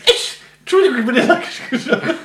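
high, loud giggly words; laughing while talking. Real, not acted. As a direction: laugh through the apology. Laughing after sneeze joke.

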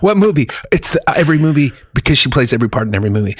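ordinary delivery

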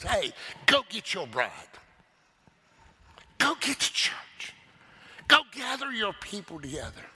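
preacher voicing the Father's order; God the Father commanding. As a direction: send the Son out with authority. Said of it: "turns to his Son and says".

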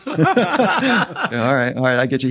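laughing through the words; amused, giggling reply to a joke. Real, not acted. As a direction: laugh while agreeing, amused.

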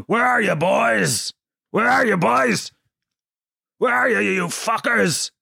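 high, drawn-out angry dad shouting. Acted. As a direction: call out slowly like a furious father hunting kids. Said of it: "an angry dad".

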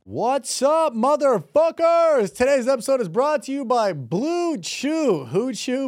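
high, drawn-out announcer greeting; hyped ad intro. Acted. Host greeting listeners to open the sponsor read, hyped announcer. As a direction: stretch it out like a hype man.

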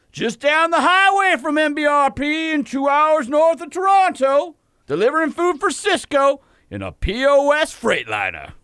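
high, slow booming cartoon drawl; blustery Southern rooster voice. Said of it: "You're an odd-sounding Canadian".

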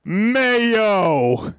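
drawn-out silly impression; Jim Cornette. Host in speed round of impressions, drawn-out mock voice. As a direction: stretch the word like a catchphrase. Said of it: "Jim Cornette".

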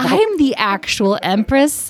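slow, lofty high voice; snooty empress. Pretend. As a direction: drag it out like royalty.

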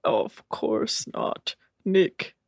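slow put-on deadpan voice; Rob. Host as Rob offering Nick a joke, deadpan. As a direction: answer in a stiff, measured monotone. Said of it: "What's that, Rob?".

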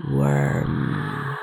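low, mocking hiss. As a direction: sneer the word low. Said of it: "the mocking word".

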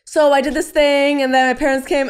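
high, loud, breezy mock summary; imitating a glib, hurried retelling; glossing over it. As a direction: skim past the big news airily. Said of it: "I skimmed over it".

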